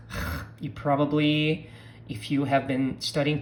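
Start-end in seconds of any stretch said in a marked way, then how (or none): 0.1-0.2 "h" as a throaty fricative, without voice. Shown as a demonstration. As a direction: make "h" a scraped breath from the throat. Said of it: "pronounce the R as h".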